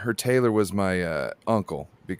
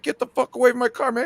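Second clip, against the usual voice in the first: much higher, loud barked yell; mock angry yell; 4 dB louder, faster than usual. Gary Payton yelling at a kid near his car, loud and high. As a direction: shoo the kid off in a rage. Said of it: "He's like".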